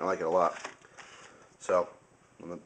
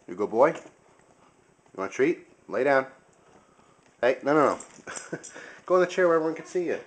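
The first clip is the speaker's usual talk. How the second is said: talking to a dog; 6 semitones higher, 3 dB louder than usual. Real, not acted. Coaxing the dog to lie down.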